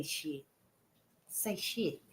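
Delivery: hushed, low imitation of a cuss word; imitated cousin. Retelling a cousin who used to cuss, hushed. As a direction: say it small and quiet, as if coaching. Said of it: "She used to say".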